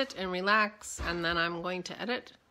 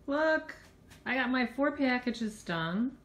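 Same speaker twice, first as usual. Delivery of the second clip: proud; 5 semitones higher, 3 dB louder, slower than usual. Real, not acted. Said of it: "I'm so excited".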